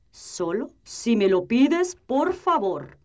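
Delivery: slow, deliberate wizard. Acted. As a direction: calmly teach her a lesson. Wizard calmly setting condition.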